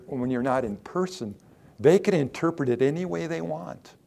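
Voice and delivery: ordinary delivery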